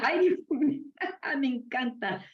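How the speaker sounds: high-pitched burst of laughter through the words; laughing. Real, not acted. Teacher cracks up at a listener's remembered saying, laughing. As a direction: crack up, squeal it out, then soften.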